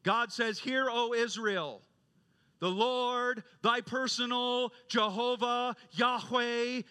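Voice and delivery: high, loud declaration, phrase by phrase; impassioned proclamation. Retold or imitated. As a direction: proclaim scripture to the young people with full force. Quoted professor proclaims to the class.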